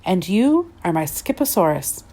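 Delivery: ordinary delivery, nothing marked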